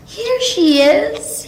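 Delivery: put-on very high, loud, slow Baby Bear cry; tiny voice crying out. A narrator's reading. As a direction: squeal it out as a little bear. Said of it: "he cried".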